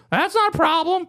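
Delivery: put-on high, wheedling old man voice; high-pitched old neighbor impression. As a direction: imitate an old man asking sweetly, way up high. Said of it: "He goes".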